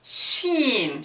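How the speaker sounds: ordinary talk, nothing marked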